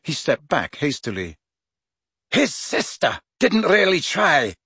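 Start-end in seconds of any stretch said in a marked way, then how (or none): 2.3-4.5 higher-pitched, mildly menacing taunt. A narrator's reading. McLaggen squares up to Harry, menacing. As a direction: needle him with a higher, menacing edge. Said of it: "said McLaggen menacingly".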